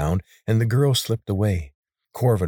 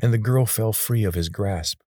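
usual speaking voice

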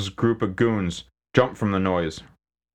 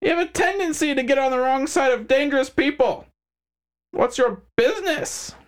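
smug crime boss; 3 dB louder than usual. Acted. McHines voiced character.

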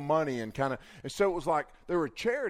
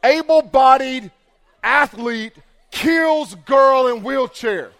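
much louder and higher, slow, through laughter; mock headline shout; 7 semitones higher, 11 dB louder, much slower than usual. The speaker imagines newspaper headlines about the fall, a slow, laughing shout. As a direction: bellow the headline for the crowd. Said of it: "look like in the headlines".

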